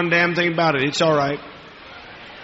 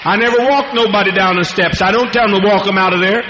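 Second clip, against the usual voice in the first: raised, driving pulpit voice; fiery preaching; 4 semitones higher than usual. Real, not acted. Faith healer defies his doubters in fiery preaching. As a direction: hammer the defiance home. Preaching defiantly at full force.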